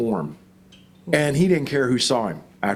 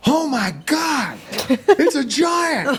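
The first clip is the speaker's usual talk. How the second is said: high, slow astonished cry with a laugh; stunned gasp; 12 semitones higher, slower than usual. Retold or imitated. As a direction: gape up at the ridge in disbelief. Son sees giant, retold.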